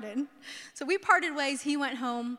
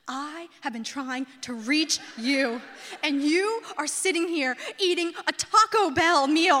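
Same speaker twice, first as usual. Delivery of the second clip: slow, deliberate rebuke building louder; exasperated scolding; 5 dB louder, slower than usual. Retold or imitated. Fiance handing back her phone, exasperated scolding. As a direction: spell it out word by word, mounting to loud.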